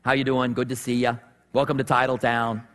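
a hint of Boston townie accent. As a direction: do a low Boston guy accent. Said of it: "He's got that Boston accent".

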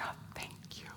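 breathy relieved whisper of thanks. Retold or imitated. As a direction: barely voice the gratitude.

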